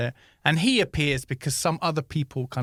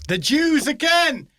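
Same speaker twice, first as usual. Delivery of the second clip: mock outrage; 11 semitones higher, 5 dB louder, slower than usual.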